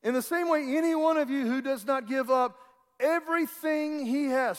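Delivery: preacher repeats the verse at full force; forceful preaching. Acted. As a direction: drive it home to the whole church.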